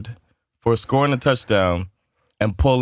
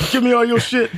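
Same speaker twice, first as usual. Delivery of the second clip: higher, louder hammed-up stickup demand; mock robber; 13 semitones higher, 3 dB louder than usual. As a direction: play a joke robber barking orders.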